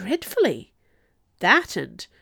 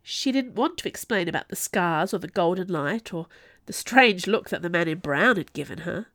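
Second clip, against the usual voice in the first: plain reading voice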